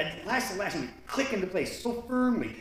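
ordinary delivery

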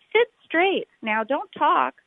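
high, sharp schoolteacher order; strict teacher. Acted. The teacher tells the class to sit still, high stern order.

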